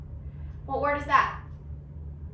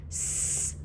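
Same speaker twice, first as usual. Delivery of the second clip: whispered letter; 11 dB quieter than usual. Shown as a demonstration. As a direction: whisper the S sound. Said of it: "ah sound in this word".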